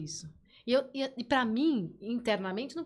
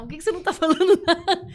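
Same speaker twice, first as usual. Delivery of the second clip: higher, louder, laughing imitation of a girl; pleading daughter; 8 semitones higher, 12 dB louder, faster than usual. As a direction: mimic her pleading through a laugh. Daughter repeats question.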